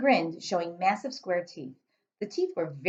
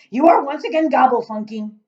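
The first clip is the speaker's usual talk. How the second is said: much louder, higher giant shout; 7 semitones higher, 12 dB louder than usual. Acted. Giant shouting at Sophie for twisting words, loud. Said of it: "the giant shouted".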